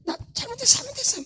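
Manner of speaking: put-on high, soft childlike pleading; a little child softly begging forgiveness; child begging mom. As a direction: whine it like a kid caught misbehaving. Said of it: "애들 막 잘못하면 막 그러죠 엄마한테".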